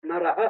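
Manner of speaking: নরহ with a sounded final h; visarga. Shown as a demonstration. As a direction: finish নরহ on a short aspirated "ha". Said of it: "সেখানে তো এরকম উচ্চারণ হবে". (নরহ-র শেষে বিসর্গ উচ্চারণ)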